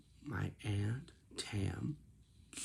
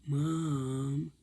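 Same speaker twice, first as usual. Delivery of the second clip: higher, louder whiny call of "Mom"; whiny complaining; 6 semitones higher, 7 dB louder than usual. Retold or imitated. Aunt complaining to her mother, whiny. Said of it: "my aunt says in this tone".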